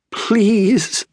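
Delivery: high pleading wail. A narrator's reading. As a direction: wail it like a suffering storybook fox. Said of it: "the fox wailed".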